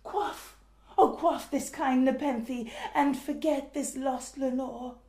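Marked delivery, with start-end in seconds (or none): 0.0-3.7 high and loud, urgent declaiming; crying out. Acted. The mourner cries out to himself of relief from Lenore, loudly. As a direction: hurl the promise of relief upward. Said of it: "I cried".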